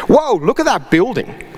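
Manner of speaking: re-enacting the wowed driver pointing; retold excited exclamation. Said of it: "immediately I yell".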